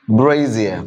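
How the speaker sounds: "Brazier" as low, falling BRAY-zee-uh; correct pronunciation. Shown as a demonstration. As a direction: repeat "Brazier" carefully with a buzzed z. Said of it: "It's brazier".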